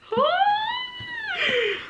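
long, very high squealed gasp; long high gasp of excited shock; overwhelmed gasp. Real, not acted. Fan who didn't expect this, gasping in shock. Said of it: "I'm sorry about the noises".

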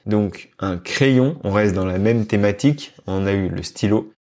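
ordinary talk, nothing marked

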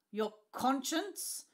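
Your conscience? drawn out high, loud, slow; scornful. A narrator's reading. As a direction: scoff at the word conscience. Scornful echo of father's word.

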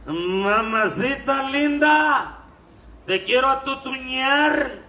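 put-on very slow, drawn-out drunken come-on; a sloppy, lingering drunk's catcall. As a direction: slur it like a drunk hitting on a nun. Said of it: "le dice el borracho a la monja".

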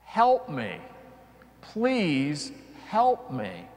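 normal talking voice

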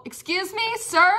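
a polite refusal in a lady's voice. Pretend. Repeating the lady's line.

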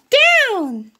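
high little pig call; Piggy calling the move to Gerald, high voice. Acted.

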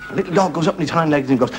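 ordinary talk

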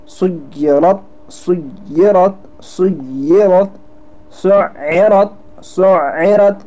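"सुय्यरत" with a hard held middle consonant; slow, forceful doubling inside "सुय्यरत"; firm doubled consonant. Shown as a demonstration. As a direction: press into the middle of "सुय्यरत" and hold before releasing. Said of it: "यानी सख्ती पैदा करनी है".

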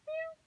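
high mock meow; cat meow. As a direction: mew like a cat to tease. Imitating a cat.

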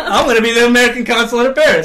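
loud, fast, hammed-up protest; rushed mock-furious declaration; mock outrage. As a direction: insist loudly like a deluded assassin, half laughing. Acting out the angry would-be consul.

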